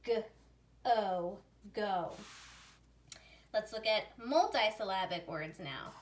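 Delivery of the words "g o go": short "G", long "O", then "go"; sounding out. Shown as a demonstration. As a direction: sound out "G, O, go" with pauses. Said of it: "make its long sound O".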